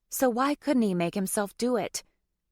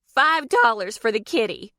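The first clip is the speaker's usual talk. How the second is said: loud, higher demanding shout; 4 semitones higher, 6 dB louder than usual. A narrator's reading. Deborah demanding the swear fine, shouting. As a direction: call out the fine loudly. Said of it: "Deborah shouted".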